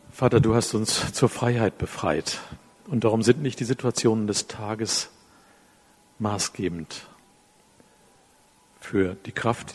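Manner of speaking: hushed prayer that ends the sermon. Acted. Closing prayer.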